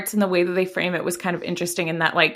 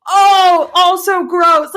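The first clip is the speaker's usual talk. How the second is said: exaggerated disgust; 9 semitones higher, 7 dB louder, much slower than usual. Reacting with disgust, retold.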